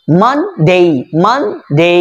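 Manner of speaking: মানডে split, short "man", long "deyy"; drawn-out ending. Shown as a demonstration. Teacher modelling short then long, drawn-out ending. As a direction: stretch the ডে in মানডে. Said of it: "এবং এখানে টান হবে".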